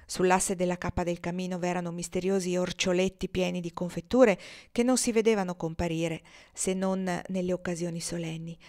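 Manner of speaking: ordinary delivery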